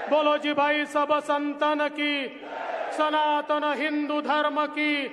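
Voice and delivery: shouted rally chants, slow and loud; roaring rallying speech. Acted. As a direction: lead the crowd in chanting slogans.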